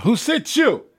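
high, forceful challenge called out; out loud. Shown as a demonstration. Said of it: "have to say it out loud".

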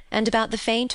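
ordinary delivery

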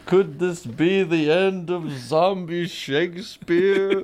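high, slow theatrical cartoon voice; dramatic quote. Quoting a Simpsons joke, slow high theatrical voice. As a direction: ham it up like a stage actor.

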